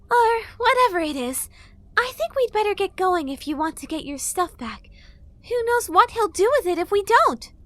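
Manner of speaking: high, loud impatient fairy; fairy girl voice. A narrator's reading. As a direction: hurry him along.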